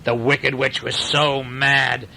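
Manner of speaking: ordinary delivery, nothing marked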